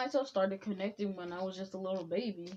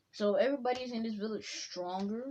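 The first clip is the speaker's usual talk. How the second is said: ordinary delivery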